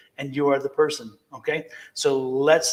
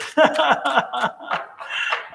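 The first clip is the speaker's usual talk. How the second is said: high burst of real laughter; 11 semitones higher, 4 dB louder than usual. Real, not acted. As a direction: laugh out, delighted by the hearts. Laughing at viewer's hearts.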